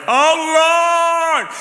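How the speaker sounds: high loud cry of Oh, Lord; mock terrified shout; retold scream. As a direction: yell it as the cable gives. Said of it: "It was like".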